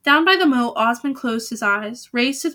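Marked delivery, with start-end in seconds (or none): none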